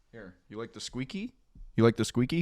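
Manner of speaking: soft coaxing pet voice; talking to a pet. Real, not acted. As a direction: coax the dog gently. Talking to dog.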